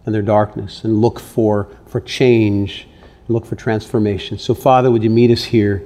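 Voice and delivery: hushed pastoral prayer. Real, not acted. Pastor prays over congregation.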